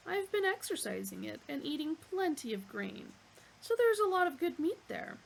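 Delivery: eager butcher's-block waiter voice; bovine waiter. Acted. Dish of the day offers itself, eager waiter.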